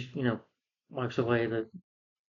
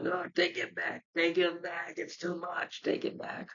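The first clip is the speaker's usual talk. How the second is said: high pleading that fades to a whisper; beg, then lose your voice to a whisper; mock panicked villain; 5 semitones higher, 8 dB quieter, slower than usual. Reviewer imitates the villain overloaded with power, panicked then whispering.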